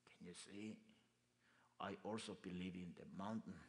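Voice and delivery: a lightly voiced old local man, soft and slow; a hint of the Taos elder; quoting the local man. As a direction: quote him quietly, slowly. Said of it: "그렇게 얘기를 하더라고요".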